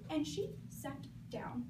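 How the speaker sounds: usual speaking voice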